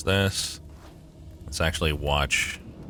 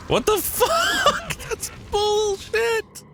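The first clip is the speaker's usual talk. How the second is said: squeaky outraged voice. Acted. Raging at unfair game traps.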